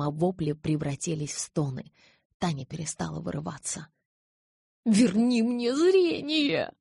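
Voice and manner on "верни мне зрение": high, drawn-out snarling cry; furious growled shout, slow and high; angry growl. A narrator's reading. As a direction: roar the demand, voice soaring. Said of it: "прорычала она".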